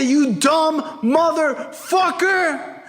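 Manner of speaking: high, slow, stretched-out rebuke; exasperated scolding. Retold or imitated. Father scolds his arrested son, exasperated scolding. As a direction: drag out each word in mock outrage.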